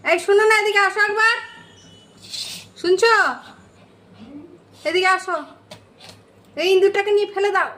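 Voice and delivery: high, fast call to another room; yelling across the room. Real, not acted. Calls someone to remove mouse.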